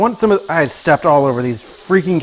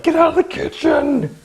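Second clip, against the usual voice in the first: mock yell; 12 semitones higher than usual. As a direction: imitate yelling at the dog. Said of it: "like, yelling at her".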